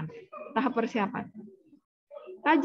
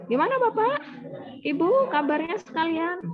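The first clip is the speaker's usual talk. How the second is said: high, bright call out to a whole group; loud greeting; 6 semitones higher than usual. Shown as a demonstration. Nurse greeting a group of elderly people, loud and high. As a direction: greet the crowd so the back row hears. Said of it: "perbedaannya hanya pada intonasi dan frekuensinya".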